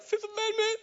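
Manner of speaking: very high, laughing squeak; squeaky frightened. Retold or imitated. A scared teen squeaks to the police officer. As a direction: let it crack up into a high squeak. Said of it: "it was more like".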